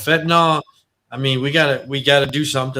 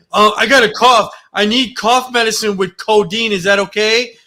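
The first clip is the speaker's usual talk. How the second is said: loud, higher-pitched put-on request; imitated pleading patient; 8 semitones higher, 8 dB louder than usual. Patient asking urgent care for codeine, loud put-on plea. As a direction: act out a patient angling for codeine.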